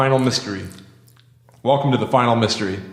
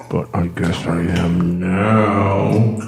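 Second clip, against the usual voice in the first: slow, spacey echo effect; drawn-out voice through a moon filter; 4 semitones lower, 3 dB louder, much slower than usual. Real, not acted. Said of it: "my mic isn't really on the moon".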